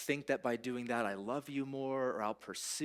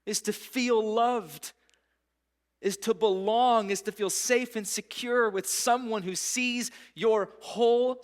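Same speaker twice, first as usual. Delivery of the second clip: higher and louder, pressing each phrase; preaching at full force; 6 semitones higher, 5 dB louder than usual. Acted. Preacher pleading with the congregation, higher and louder. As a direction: preach it, building passion.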